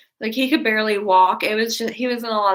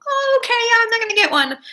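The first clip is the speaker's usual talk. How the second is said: much higher, louder mock-innocent agreement; mock agreeable; 5 dB louder, faster than usual. Teen fake-agreeing with mom about the turtle, high and sweet.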